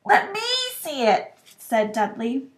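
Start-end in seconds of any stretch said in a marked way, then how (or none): 0.0-1.4 put-on whiny boy. A narrator's reading. Dudley demands.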